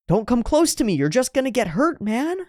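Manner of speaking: high, strained plea to stay away; high desperate warning to her partner; high pleading Lucy. Retold or imitated. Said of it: "she tries one last time to say".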